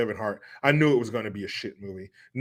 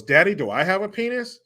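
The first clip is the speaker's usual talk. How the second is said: put-on higher innocent kid voice; little girl; 7 semitones higher, 4 dB louder than usual. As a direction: ask it like a curious five-year-old. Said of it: "then she goes".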